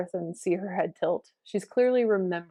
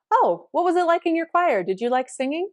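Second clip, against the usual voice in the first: high, louder curious tone; interested question; 10 semitones higher, 6 dB louder than usual. Retold or imitated. Teacher asking student about childhood choir, keenly interested. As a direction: lift it right up with keen interest.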